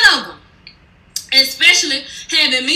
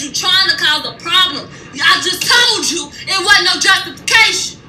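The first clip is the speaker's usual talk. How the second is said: pissed off; 3 semitones higher than usual. Retold or imitated. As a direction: read her the riot act, shouting her down. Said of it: "here goes when I get pissed off".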